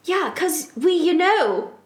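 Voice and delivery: high, loud mock outrage; angry yell. A narrator's reading. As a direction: yell it in hammed-up annoyance. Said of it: "I yelled in an angrily voice".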